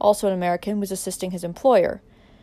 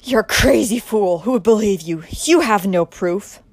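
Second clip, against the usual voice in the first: loud, slow sneering defiance; villain scoffing loudly at the accuser; harsh scorn; 9 dB louder than usual. Acted. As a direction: spit it at him, mocking. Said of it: "broke into a harsh laugh".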